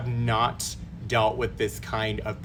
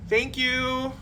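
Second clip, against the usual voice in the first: sympathetic thank you; 13 semitones higher than usual. Retold or imitated. Sympathetic tech thanks her.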